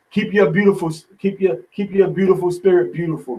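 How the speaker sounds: normal talking voice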